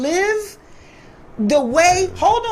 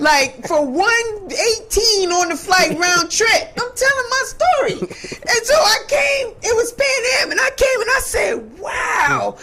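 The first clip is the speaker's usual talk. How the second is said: excited retelling; 7 semitones higher than usual. Retold or imitated. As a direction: relive the thrill of stepping off the plane. Excitedly retelling first arrival in Atlanta.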